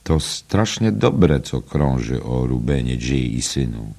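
usual speaking voice

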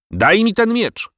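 slow, very high-pitched demand; drawn-out shout, voice leaping up; demanding cry. A narrator's reading. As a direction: cry out as an outraged king. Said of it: "zawołał król, odzyskując głos".

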